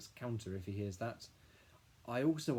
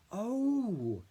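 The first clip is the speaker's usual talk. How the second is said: high, louder sudden oh of realisation; startled oh; 12 semitones higher, 8 dB louder than usual. A narrator's reading. Billy realising the wink meant nothing, high surprised oh. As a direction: catch the mistake out loud like a small boy. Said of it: "hisses Billy".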